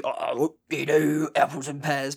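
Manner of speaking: exaggerated Cockney voice, slow and loud; Cockney accent. Ben doing Cockney for the podcast crew, exaggerated Cockney accent. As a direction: ham up a London Cockney. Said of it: "Ben's Cockney accent's flawless".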